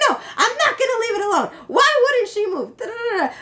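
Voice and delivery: very high, squealing imitation of a ranting woman; hammed-up lunatic screeching, trailing into gibberish; screechy mock screaming. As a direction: screech it like a mad little chihuahua, without real volume. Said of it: "screaming like a lunatic, like a little chihuahua".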